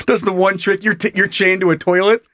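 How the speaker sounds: usual speaking voice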